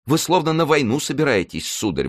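high-pitched servant's outcry; exclaiming. A narrator's reading. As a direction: exclaim to the master, starting high. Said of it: "воскликнул Мушкетон". (восклицание высоким голосом слуги)

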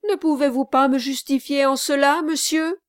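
high, loud, quick appeal; eager exclamation. A narrator's reading. Kit begs the gentleman to justify him, eager exclamation. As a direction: urge him keenly to back you up. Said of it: "s'écria vivement Kit".

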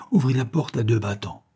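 ordinary delivery

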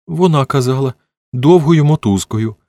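put-on high, slightly tremulous child's voice; a touch of fearful quiver; trembling voice. A narrator's reading. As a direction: explain timidly, like a scared little boy. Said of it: "пояснював Тіммі тремтячим голосом".

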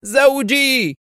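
high-pitched cry of horror; a wife's stricken, high wail; horrified cry. A narrator's reading. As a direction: cry out in terror for a husband. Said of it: "وهي تهتف في ارتياع".

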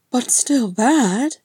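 high, loud, slow prod; prompting. Acted. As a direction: draw out a rising question. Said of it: "Frerin prompted".

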